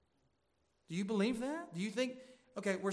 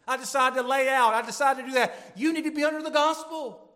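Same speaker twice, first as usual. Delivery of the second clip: much louder and higher, driving each phrase; full-force preaching; 7 semitones higher, 10 dB louder than usual. Real, not acted. Pastor urging the church to stay under the gospel, full force. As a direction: preach it hard to the congregation.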